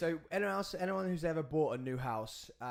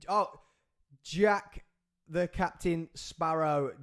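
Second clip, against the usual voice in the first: ordinary delivery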